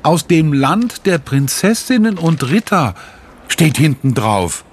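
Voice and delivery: put-on high, eager child's voice; a girl showing off her letter, pitched high; excited girl. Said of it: "ruft sie".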